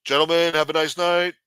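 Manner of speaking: imitating a dismissive doorman; security guard. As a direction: turn them away like a club bouncer. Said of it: "Big-ass security guards used to say".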